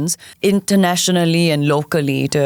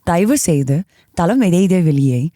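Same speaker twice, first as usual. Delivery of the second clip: MRT train announcement. Shown as a demonstration. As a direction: read it like the train PA. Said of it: "this is what it sounds like".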